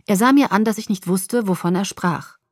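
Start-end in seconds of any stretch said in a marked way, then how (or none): none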